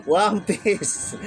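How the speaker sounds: real giggle running into the words; laughing at own slip. Real, not acted. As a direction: laugh as you correct your own mistake.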